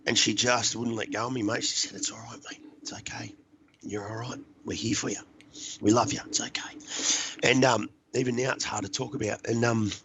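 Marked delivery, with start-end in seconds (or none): 1.9-7.5 soothing whisper. Retold or imitated. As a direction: whisper it like holding someone who is crying. Comforting him while he sobs.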